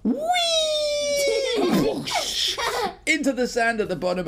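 high loud laughing squeal; laughing whee. Acted. Boy diving off the slide, laughing loud squeal. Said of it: "he'd go".

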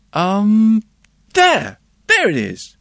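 put-on high, loud child's voice; a small boy answering the policeman; little boy voice. A narrator's reading. Boy's voice in story.

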